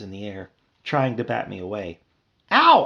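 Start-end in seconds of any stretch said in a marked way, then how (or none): 2.5-2.8 sharp loud high ow; cry of pain. Acted. Bee yelping in pain as he is slapped. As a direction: yelp as you're slapped. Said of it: "I cried".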